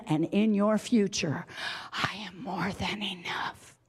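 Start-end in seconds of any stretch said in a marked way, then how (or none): 1.9-3.8 soft whispered assurance; intimate whisper. Acted. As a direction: breathe it quietly. Quiet declaration after climax.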